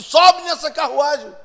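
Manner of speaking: loud, high, stretched-out order; insistent command. Acted. God orders Felipe up into the chariot, insistent command. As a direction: call it out insistently.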